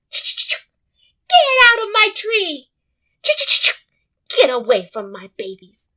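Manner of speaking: high, slow furious squirrel shriek at the bear; angry squirrel scream. Acted. Mother squirrel screaming at the bear to leave her babies.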